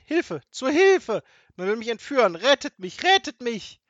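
very high, loud alarmed cries; screaming for help. A narrator's reading. Kidnapped grandmother calls for rescue, screaming. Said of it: "Ich werde um Hilfe schreien".

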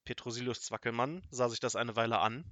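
ordinary delivery

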